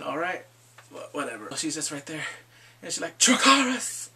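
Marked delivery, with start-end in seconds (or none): 3.2-4.1 high, loud acted battle cry; shouted last command. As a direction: yell it out as an imitated final command.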